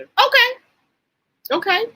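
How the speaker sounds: high, loud okay of delight; excited agreement. Real, not acted. Host reacting to a viewer's comment, squealing okay. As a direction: squeal okay back at the chat.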